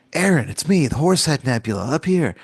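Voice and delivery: high call from the sky; nebula calling. Pretend. Horse head nebula calling to Aaron, high and friendly. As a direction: chirp it down from above.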